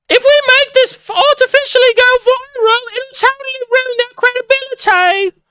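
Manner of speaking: very high, sneering impression of a scheming troll; mocking squeaky voice. Mocking the plotters in a very high squeaky voice. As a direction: squeak it out like a smug conspirator. Mocking an online opponent's plan.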